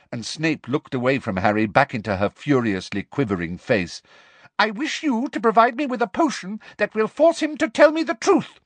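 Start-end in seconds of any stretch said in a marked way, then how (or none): 4.6-8.6 much higher, louder furious demand; angry Umbridge. A narrator's reading. Umbridge orders Snape to supply truth potion, furious. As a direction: insist through fury.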